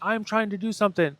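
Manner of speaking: proclaiming to the universe; declaring it. Retold or imitated. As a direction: call it out to the universe. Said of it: "declaring this to the universe".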